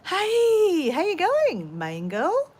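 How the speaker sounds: high, loud friendly greeting to a passerby; calling out hello. Real, not acted. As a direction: call out warmly to someone walking by.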